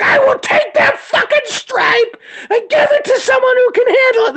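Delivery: shrieked, very high-pitched threat; gleefully over-the-top re-enacted yelling; mock threat. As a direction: holler it way up high, hammed-up. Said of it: "When you threaten me".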